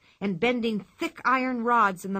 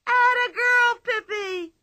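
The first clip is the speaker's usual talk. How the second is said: higher, louder crowd cheer; crowd shrieking; 14 semitones higher, 6 dB louder, slower than usual. Acted. The whole circus crowd joining the cheer, shrieking. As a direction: scream it like a whole tent. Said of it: "began to shriek".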